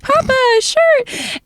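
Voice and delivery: put-on high, drawn-out child whine; slow high-pitched mock plea; imitated insistent kids. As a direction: mimic a kid pestering grandpa. Kids pestering grandpa.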